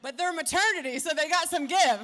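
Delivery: loud, high laughing delivery; big laugh through the words; laughing loudly. Real, not acted. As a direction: crack up while telling it. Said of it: "laughing at own story".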